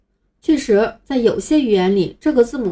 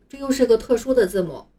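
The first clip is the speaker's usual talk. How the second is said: ordinary delivery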